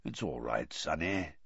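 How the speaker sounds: hushed, low, kindly growl; low, gentle growl. A narrator's reading. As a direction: growl it gently to calm someone. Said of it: "much lower and gentler growl".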